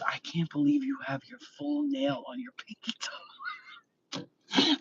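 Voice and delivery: awed whisper, slow and soft; whispered like telling a secret. Retold or imitated. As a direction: breathe it out in hushed amazement. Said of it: "He goes".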